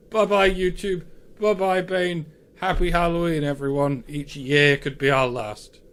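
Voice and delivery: hammy villain gloating; sneering panto villain. Acted. Mock sinister Halloween farewell.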